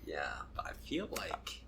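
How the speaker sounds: hushed whisper. Real, not acted. Friend whispers a hint about the embarrassing video. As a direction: drop to a conspiratorial whisper.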